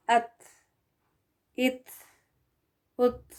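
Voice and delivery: अत, इत, उत with an audible breath of air after the त; airy final t. Shown as a demonstration. Teacher shows students the breathy final t, airy and light. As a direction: release each final त with a puff of air. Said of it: "आ रही है हवा की आवाज़ आखिर में".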